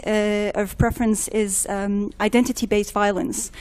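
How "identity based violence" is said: normal talking voice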